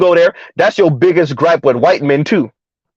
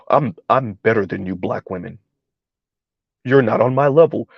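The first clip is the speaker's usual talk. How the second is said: lower, softer, condescending gentleman voice; Bartholomew the third voice; 7 semitones lower, 3 dB quieter than usual. Retold or imitated. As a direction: talk down low and smooth like a snooty gent. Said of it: "they put on the Bartholomew the third voice".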